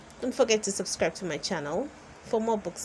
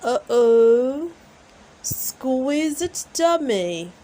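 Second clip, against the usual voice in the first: Daddy Pig voice; 4 semitones higher, 7 dB louder, slower than usual. A narrator's reading. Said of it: "says Daddy Pig".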